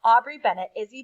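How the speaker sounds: ordinary delivery, nothing marked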